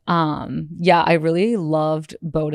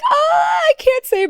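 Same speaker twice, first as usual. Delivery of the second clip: very high, loud squeal; squealed, teasing refusal; coy refusal; 5 dB louder than usual. Retold or imitated. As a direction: imitate a handler squirming to keep a secret. Imitating handlers refusing to tell.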